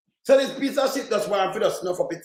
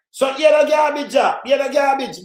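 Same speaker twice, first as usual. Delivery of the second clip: high, loud scornful call; scornful shout; 5 semitones higher, 5 dB louder than usual. Real, not acted. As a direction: announce the garbage with loud contempt. Ranting about a politician's statement.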